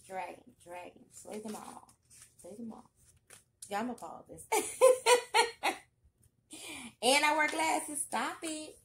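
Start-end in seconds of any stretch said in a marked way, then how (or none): 4.5-5.8 high, loud burst of laughter. Real, not acted. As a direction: crack up out loud. Cracking up, pausing the video.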